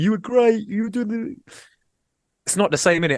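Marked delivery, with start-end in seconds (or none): 0.0-1.4 fan praise. Retold or imitated. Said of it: "people messaging me going".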